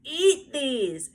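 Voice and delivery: put-on high, loud little-girl shout; loud bossy child's shout. A narrator's reading. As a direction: shove the order at her. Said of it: "she shouted".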